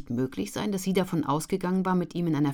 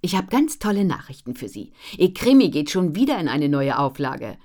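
louder, eager announcing; excited news; 9 dB louder than usual. A narrator's reading. Editor tells Ellen about the new print run, excited. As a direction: burst out with the good news.

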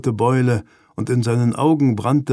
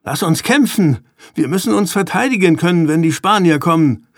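heated, higher-pitched outburst; angry snapping; 6 semitones higher, 4 dB louder than usual. A narrator's reading. Ruben demanding to fight before the Spaniards come, snapping angrily. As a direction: snap back louder and fast, itching to fight. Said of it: "fauchte Ruben".

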